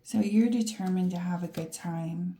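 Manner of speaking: ordinary talk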